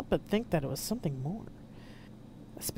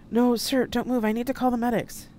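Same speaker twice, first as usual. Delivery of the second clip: high, loud, panicked plea; urgent alarm; 9 semitones higher, 7 dB louder than usual. A narrator's reading. Aide stops the wounded Admiral moving, urgent alarm. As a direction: urge him frantically to stay still.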